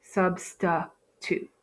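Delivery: "substitute" slowly in syllables, sub-stuh-toot; slow, broken-up "substitute"; schwa demonstration. Shown as a demonstration. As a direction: sound out "substitute" with an uh in the middle. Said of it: "We have a schwa".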